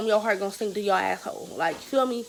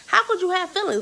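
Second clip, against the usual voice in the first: incredulous; 10 semitones higher, 3 dB louder than usual. Retold or imitated. Mocking him.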